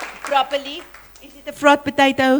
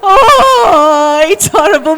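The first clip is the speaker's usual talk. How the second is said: disgusted cry; 2 semitones higher, 6 dB louder, slower than usual. Retold or imitated. Disgust at rotten potato.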